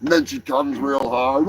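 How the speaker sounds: normal talking voice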